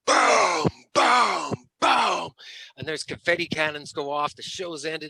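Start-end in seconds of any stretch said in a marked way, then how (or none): 0.1-2.3 drum booms. Retold or imitated. Imitating the final drum hits.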